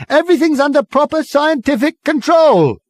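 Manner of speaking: high, loud official shout. Acted. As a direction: bluff authority at volume. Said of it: "shouted Ford again".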